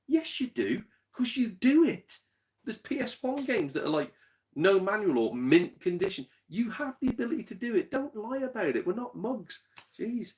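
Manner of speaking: high-pitched, indignant rant; worked up. Real, not acted. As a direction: protest as if calling out an obvious lie. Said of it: "I'm working myself up here".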